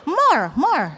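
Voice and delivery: high, bouncy swooping encouragement; mock-enthusiastic English cheerleading; imitating her teacher self. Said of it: "saya benar-benar seperti itu".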